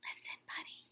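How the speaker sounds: soft unvoiced whisper. Parents whispering to Buddy, hushed whisper. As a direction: breathe it, no voice at all. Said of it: "They tried whispering".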